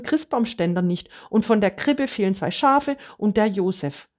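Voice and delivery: high, pleading and distraught; upset outburst. A narrator's reading. Peter klagt seinem Freund verzweifelt sein Unglück. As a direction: voice pitched high with despair, tumbling out.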